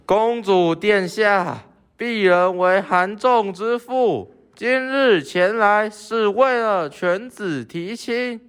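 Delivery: calling out. Acted. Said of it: "在城门前大喊着".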